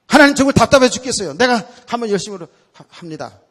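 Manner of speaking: fast, loud, high complaint; fed up and rushing ahead; exasperated. Retold or imitated. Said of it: "frustrated believer to God". (답답한 신도가 하나님께 투덜대듯 빠르게)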